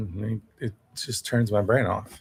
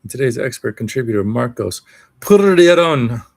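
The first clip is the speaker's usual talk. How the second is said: put-on Mexican businessman accent; 2 semitones higher, 8 dB louder than usual. Introducing today's expert contributor, hammed-up Mexican businessman accent. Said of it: "on the Mexican part".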